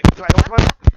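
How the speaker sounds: ordinary delivery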